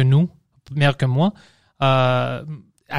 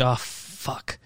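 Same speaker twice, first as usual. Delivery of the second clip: hushed, voiceless swear; retold resigned curse under the breath; muttered dread; 2 semitones lower, 10 dB quieter than usual. Retold dread before going onstage. (juron découragé, tout bas)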